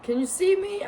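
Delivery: high, loud check; calling out. Real, not acted. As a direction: call out to the camera. Checking the camera.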